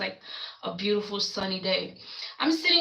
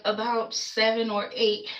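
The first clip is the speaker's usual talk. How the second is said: ordinary talk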